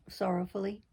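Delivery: ordinary delivery, nothing marked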